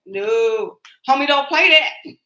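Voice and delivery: quoting a favorite In Living Color sketch, loud and high; In Living Color catchphrase. Retold or imitated. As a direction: belt it like the TV clown's catchphrase. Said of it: "That was one of my favorite, In Living Colors sketches".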